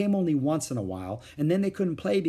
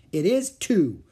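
loud, high defiant retort; 6 semitones higher, 6 dB louder, slower than usual. A narrator's reading. Little girl defying Mother, loud and high. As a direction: throw it back at Mother. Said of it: "said Ramona defiantly".

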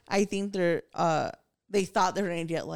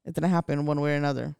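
ordinary talk, nothing marked

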